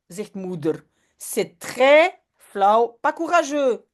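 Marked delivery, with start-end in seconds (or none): 1.2-3.9 slow scolding. Acted. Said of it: "dit maman".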